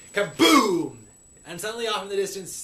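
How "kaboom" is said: loud, high shouted sound effect; explosive, drawn-out boom; booming explosion. A narrator's reading. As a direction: shout it like a blast going off. Narrator imitates the explosion.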